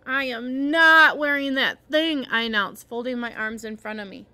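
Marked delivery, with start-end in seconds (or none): 0.0-2.3 slow, loud, stubborn groan; groaned refusal. A narrator's reading. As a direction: dig in your heels, stretching the words out loud. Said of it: "I groaned".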